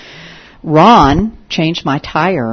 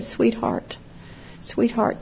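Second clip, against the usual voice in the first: tender; 6 semitones higher, 8 dB quieter, much slower than usual. Retold or imitated. Comforting grieving young woman.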